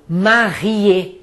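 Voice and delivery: marié with a French r and a closed final é; marié, uvular r, end on é. Shown as a demonstration. Teacher showing students how marié sounds. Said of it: "só pronuncio o E final quando ele for acentuado".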